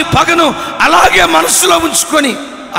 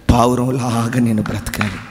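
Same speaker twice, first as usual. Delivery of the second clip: hushed earnest preaching; 12 semitones lower than usual. Acted. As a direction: lean in and warn gently. (మెల్లగా, గంభీరంగా హెచ్చరిక)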